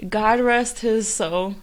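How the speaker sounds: hushed and slow, solemn; solemn quiet. Real, not acted. Mourning a dead friend.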